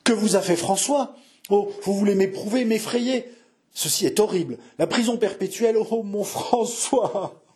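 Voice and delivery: high-pitched, anguished heroine; horrified pleading. Acted. Jeanne learning François faces perpetual prison, horrified pleading.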